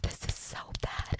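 quick tearful whisper. Acted. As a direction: whisper it fast with a catch. Watched, aroused roleplay.

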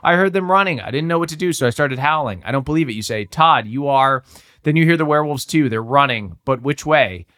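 story narration. A narrator's reading. Reads the gamebook aloud.

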